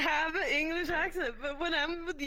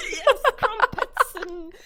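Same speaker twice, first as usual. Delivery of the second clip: laughing, much higher mock English accent, slow; hammed-up English accent; 5 semitones higher, 17 dB louder, slower than usual. Shown as a demonstration. Showing her only English accent bit, laughing mock English accent. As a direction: ham up a posh English accent through giggles. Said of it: "I can just do Harry Potter and the tea".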